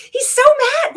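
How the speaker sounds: loud disbelieving exclamation; exasperated outburst. Real, not acted. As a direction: throw your hands up in disbelief. Exasperated at the ranting clip.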